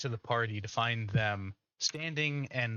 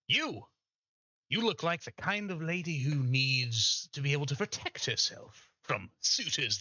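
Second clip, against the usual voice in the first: pitchy carnival barker voice; snake oil seller; 3 semitones higher than usual. Acted. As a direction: sell it like a fairground huckster. GM voices tabaxi salesman pitch.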